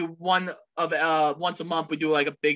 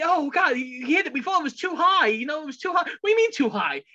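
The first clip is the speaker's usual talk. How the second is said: higher, lightly voiced movie announcers; Major League quote; 9 semitones higher than usual. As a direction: quote the baseball movie in a raised, excited voice. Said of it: "So we always go".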